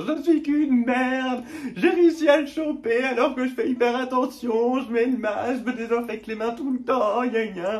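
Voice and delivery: hammed-up crying complaint; mock tearful wail. Leaving the lab positive, wailing in mock tears. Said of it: "je fonds en larmes en me disant".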